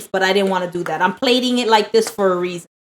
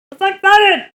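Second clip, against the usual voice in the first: high, louder and thrilled; excited; 9 semitones higher, 4 dB louder than usual. Real, not acted. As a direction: sound thrilled to dig in. About to bite.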